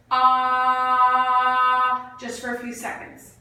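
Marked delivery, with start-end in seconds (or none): 0.1-1.4 loud steady sustained ah; strong clear ah. Shown as a demonstration. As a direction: belt a strong clear aah. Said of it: "a nice, strong, clear".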